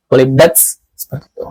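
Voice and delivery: normal talking voice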